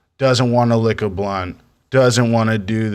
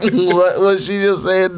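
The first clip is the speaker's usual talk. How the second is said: laughing; 9 semitones higher, slower than usual. Retold or imitated. Confused student recalled.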